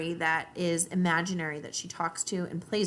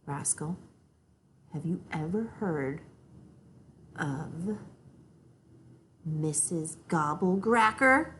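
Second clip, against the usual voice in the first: slow, hushed and spooky; very slowly; 2 semitones lower, slower than usual. Acted. Big sister slowly and spookily scaring little sister. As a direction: draw it out to scare her. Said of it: "Very slowly".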